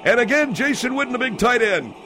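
high, loud play-by-play call; live play-by-play. Acted. Announcer calling the pass to the crowd, excited.

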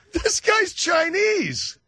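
high, slow, shouted disbelief; incredulous shout. Real, not acted. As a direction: blurt it out in astonishment. Shocked outburst about husband.

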